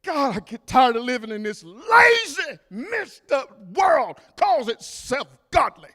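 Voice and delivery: shouting out against a lazy world; preacher crying out at a lazy world; fiery preaching. Acted. Preaching at full force.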